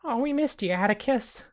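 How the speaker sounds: high, put-on character voice, sheepishly explaining; high-pitched, earnest little sidekick; innocent roommate Cheese. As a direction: squeak out a meek excuse. Game master plays Cheese.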